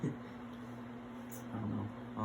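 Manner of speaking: breathless whisper into the ear. Real, not acted. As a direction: whisper it for the dog only.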